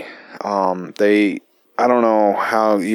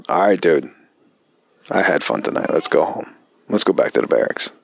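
low buddy voice; 5 semitones lower than usual. Retold or imitated. Buddy quoted, ready to leave.

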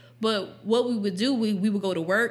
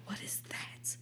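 hushed, voiceless mock bewilderment; baffled whisper; 19 dB quieter than usual. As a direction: whisper it as a clueless teenager. Acting out her own baffled reaction.

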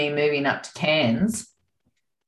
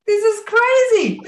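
much higher, louder incredulous cry; disbelief; 4 dB louder than usual. Retold or imitated. Retelling her reaction to the psychic, incredulous. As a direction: throw it up high in disbelief.

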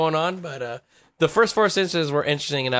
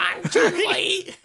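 high, slow laughing granny voice; old lady voice; much slower than usual. Retold or imitated. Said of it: "did an old lady when I was Chun-Li".

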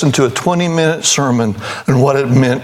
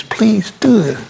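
pleading; 10 semitones higher, slower than usual. Retold or imitated. Caller begging the pastor.

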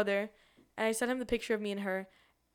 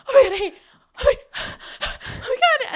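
very high, loud gasping squeals; mock starstruck freak-out; 12 dB louder than usual. Fans meeting a TikTok star, gasping freak-out. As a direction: hyperventilate in hammed-up fan panic. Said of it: "And we start going".

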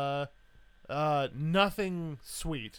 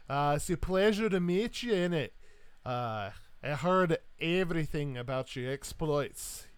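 hearty Highland Scot clan chief; big Scottish warrior greeting a captain. Acted. Scot greets Captain Crowley on his exploits, hearty Scottish accent. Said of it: "this world's equivalent of a Highland Scot".

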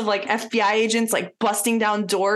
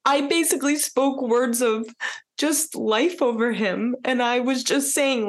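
still teary; 2 semitones higher than usual. Real, not acted. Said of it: "it's making me cry".

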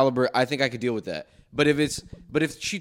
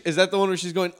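normal talking voice

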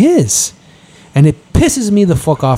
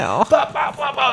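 higher, softer blah-blah babble; mock rant babble; 10 semitones higher, 8 dB quieter than usual. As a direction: babble it like nonstop ranting. Showing spit-filled rant.

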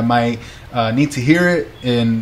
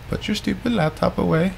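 high, soft put-on scolding; mock scolding voice; 6 semitones higher, 6 dB quieter than usual. God telling a workaholic husband off, in mock scolding. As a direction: nag softly in a raised, put-on voice. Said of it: "I say it like that, but he's like".

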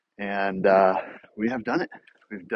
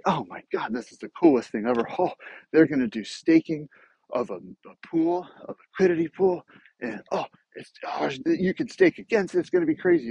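imitating excited buddy; 7 semitones higher than usual. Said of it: "came to me all super excited".

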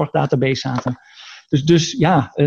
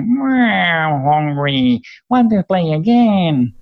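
higher toy creature voice in English, slowing at the end; Furby voice; 4 semitones higher, much slower than usual. Retold or imitated. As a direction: squeak it out like a talking toy gremlin. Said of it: "nou, dit".

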